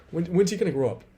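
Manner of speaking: mock the sister's nagging complaint. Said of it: "I can hear her saying".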